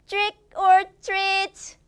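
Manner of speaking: put-on high, playful kid's door call; trick-or-treat call. Teacher voicing a child at a neighbour's door, playful call. What do we say prompt.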